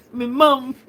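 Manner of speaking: high, loud laughing exclamation. Real, not acted. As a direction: crack up at yourself. Cracking up at own impression.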